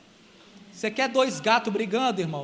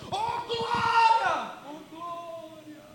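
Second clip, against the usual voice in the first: drawn-out, high mock cry of praise; hammed-up slow "Oh, glória"; mock showy praise; 6 semitones higher, 5 dB quieter, much slower than usual. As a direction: parody a noisy worshipper, slow and high. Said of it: "Fazer barulho não significa que você tem mais fé".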